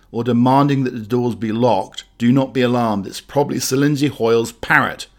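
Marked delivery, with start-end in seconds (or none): none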